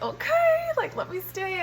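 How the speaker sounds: very high, drawn-out mock "okay"; reluctant giving-in. Patient retells agreeing with God to stay, reluctant giving-in. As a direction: give in with exaggerated high-pitched reluctance.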